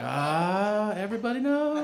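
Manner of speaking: high, sheepish whine of defeat; mock-pitiful busted realization; busted groan. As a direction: sound caught red-handed. Sees parents, busted.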